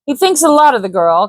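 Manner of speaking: sly trader's casual Cockney; lightly voiced Cockney trader. Hanson to Baines, lightly Cockney and sly.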